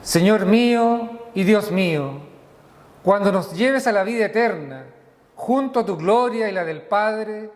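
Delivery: usual speaking voice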